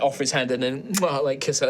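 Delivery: ordinary delivery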